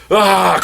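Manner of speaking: high, loud imitated yell; mock scream. Barda smashing foes mid-introduction, high mock yell. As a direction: burst into a hammed-up cry. Interrupting Mister Miracle's acted answer.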